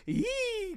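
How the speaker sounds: high-pitched mock squeal of glee; crazy glee. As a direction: squeal "ee" in exaggerated delight. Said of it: "like a crazy ... glee".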